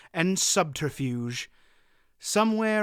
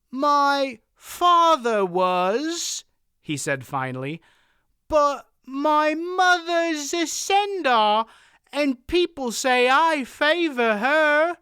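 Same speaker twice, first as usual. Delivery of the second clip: put-on much higher, slow dim-witted boy; simple boy; 12 semitones higher, 6 dB louder, slower than usual. A narrator's reading. Boy playing dumb.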